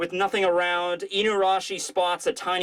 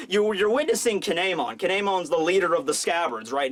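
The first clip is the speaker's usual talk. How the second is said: ordinary delivery, nothing marked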